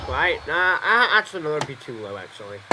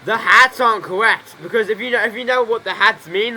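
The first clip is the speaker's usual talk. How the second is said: heated insistence; 4 semitones higher, 7 dB louder than usual. Real, not acted. Streamer ranting at chat, loud and emphatic. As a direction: hammer the point home about the hats. Excited rant about game hats.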